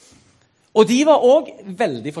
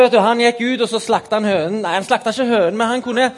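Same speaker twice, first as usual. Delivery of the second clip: ordinary delivery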